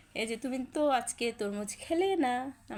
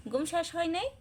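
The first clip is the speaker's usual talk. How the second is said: 1 cooing question; 8 semitones higher, 3 dB louder than usual. Real, not acted. Cooing at sleepy child.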